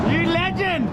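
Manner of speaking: high delighted praise; thrilled shout of praise; excited cheer. Real, not acted. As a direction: cheer the trucker on. Cheering truck driver.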